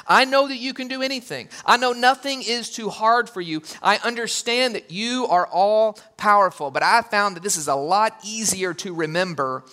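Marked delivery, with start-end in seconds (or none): 0.1-6.7 high, fervent proclamation; awed declaration. Retold or imitated. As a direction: declare God's power in awe. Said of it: "when he says".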